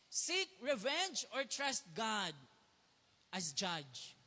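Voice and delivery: slow, deliberate reading of the choice; slow emphatic reading. Shown as a demonstration. Preacher reading the choice aloud to the congregation, slow. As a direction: read it out slowly, weighing each word. Reading a slide title slowly.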